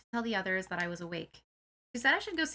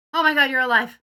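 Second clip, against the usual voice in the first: relieved shout; 4 semitones higher, 13 dB louder than usual. Acted. Friend wakes from death.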